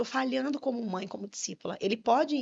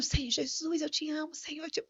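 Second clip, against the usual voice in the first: softer, higher, mock-weeping plea; imitating tearful prayer; 6 semitones higher, 5 dB quieter than usual. Son kneeling at church praying to the Lord, tearfully imitated. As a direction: lightly act out praying through tears.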